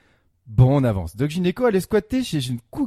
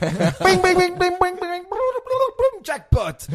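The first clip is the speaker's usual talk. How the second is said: high, loud mechanical bings held on one pitch; imitated pinball machine; 13 semitones higher, 4 dB louder, slower than usual. Columnist celebrating a pinball clue, imitated jackpot bells. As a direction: ring like a pinball machine paying out.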